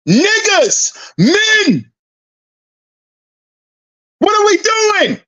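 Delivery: rising to an incredulous shout; exasperated shout. Real, not acted. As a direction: throw up your hands and yell at them. Exasperated rant at men.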